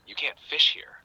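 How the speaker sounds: stern farmer. Acted. Farmer's line in a joke.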